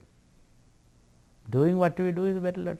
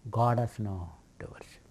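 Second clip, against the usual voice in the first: soft, unvoiced whisper; whispered aside; 6 semitones lower, 7 dB quieter than usual. Shown as a demonstration. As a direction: breathe it like a secret. Same line repeated, hushed.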